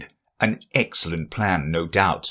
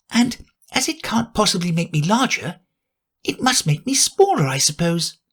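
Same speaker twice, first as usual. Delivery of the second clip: put-on higher girlish musing voice; a curious girl thinking aloud; Alice to herself; 9 semitones higher than usual.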